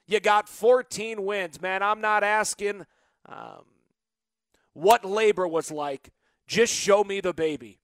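normal talking voice

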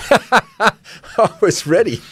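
laughing through the words; chuckling with glee. Real, not acted. Gleeful memory of bashing players.

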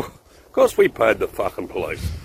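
normal talking voice

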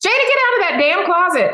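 high, loud mock scolding call. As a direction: yell her out of hiding, half laughing. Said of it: "Dolly went".

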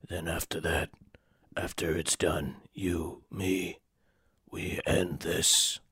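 hushed threat. Acted. Caesar threatens Woody quietly.

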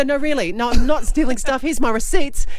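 high, insistent protest; overcompensating plea. Retold or imitated. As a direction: push your receipts at a stranger. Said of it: "I go".

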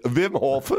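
Wim Hof with a Dutch V, vim hof; Dutch accent. Shown as a demonstration. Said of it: "But in a Dutch accent".

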